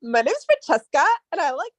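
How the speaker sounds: lightly mocking childish voice; teasing quote. Teasing her cousin by repeating her childhood line, lightly mocked. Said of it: "I'll be like".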